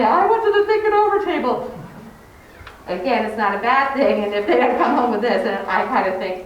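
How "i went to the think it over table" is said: put-on higher, louder little-kid voice admitting it; honest kindergartner. Teacher voicing a child confessing to parents, higher kid voice. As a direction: sound like a five-year-old owning up. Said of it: "they're usually pretty honest to say".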